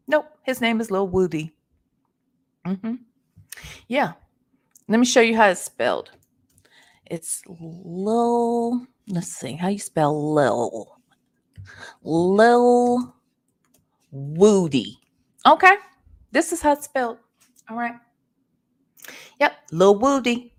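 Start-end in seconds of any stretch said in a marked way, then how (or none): none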